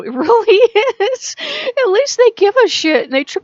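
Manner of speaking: high and loud, through laughter; laughing. Real, not acted. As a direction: laugh it out in disbelief. Laughs while mocking the book.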